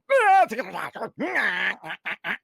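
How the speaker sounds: high, loud cartoon devil growl; wild Taz snarl. A Superman-looking guy growls like the Tasmanian Devil, high and loud. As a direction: make the superhero sound like Taz. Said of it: "guy who looks like a superhero that's like".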